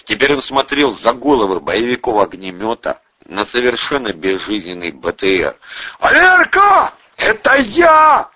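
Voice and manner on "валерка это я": high, drawn-out far-carrying shout; hollering. A narrator's reading. Vovka calls friends in the armoured carrier, hollering. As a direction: holler to someone out of sight. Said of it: "орал Вовка".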